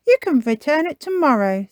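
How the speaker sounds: high, projected cartoon mum; Mummy Pig. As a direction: pitch it up like a mum giving instructions. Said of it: "says Mummy Pig".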